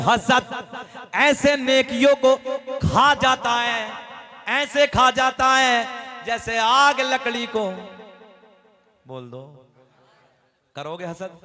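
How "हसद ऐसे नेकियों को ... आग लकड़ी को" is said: very slow, loud sermon chant; preaching at full force. Acted. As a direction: stretch each word for the crowd. Chanted hadith line, very slow.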